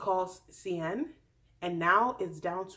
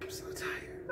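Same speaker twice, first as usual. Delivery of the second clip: tired whisper; 9 dB quieter than usual. Acted. Exhausted aside, whispered.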